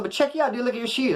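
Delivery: high-pitched mocking jeer; teasing. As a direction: clown him about what he is wearing. Clowning the other guy's shoes.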